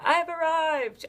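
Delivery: a hammed-up talk show host triumph; Oprah impression. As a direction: announce it like Oprah, big and sweeping. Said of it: "I'm like, you know, Oprah".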